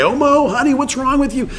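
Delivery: high cooing new-parent voice; doting parent. Retold or imitated. New parent fussing over a crying toddler, high cooing.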